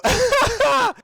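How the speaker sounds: loud burst of laughter; laughing. Real, not acted. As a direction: crack up mid-question. Cracks up mid-question.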